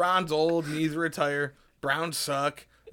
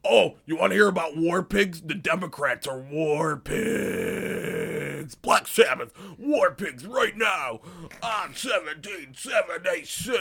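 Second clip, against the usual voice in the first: slow hyped DJ shout-out, louder; mock radio DJ; 2 semitones higher, 5 dB louder, slower than usual. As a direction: hype the song like a cheesy rock DJ.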